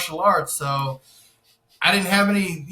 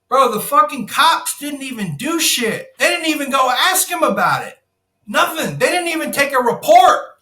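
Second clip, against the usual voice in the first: worked-up, higher-pitched outrage; indignant; 6 semitones higher, 4 dB louder than usual. Real, not acted. Venting to a friend that cops took no report, indignant. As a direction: vent in disbelief, voice climbing high. Indignant about police doing nothing.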